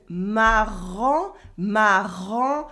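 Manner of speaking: "marrant" drawn out slowly with a back-of-throat R; French R. Shown as a demonstration. Teacher showing learners the French R, throaty R. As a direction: sound out "marrant", throaty R and open nasal ending. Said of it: "the French R that you can hear".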